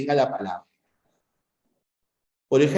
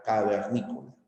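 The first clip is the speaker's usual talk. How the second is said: soft repeat; 7 semitones lower, 9 dB quieter, faster than usual. Shown as a demonstration. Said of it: "puede llevar la tilde".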